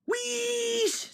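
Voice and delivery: high held wheesh of steam; Percy startles Henry with a high steam hiss; sudden steam wheesh. A narrator's reading. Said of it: "said Percy suddenly".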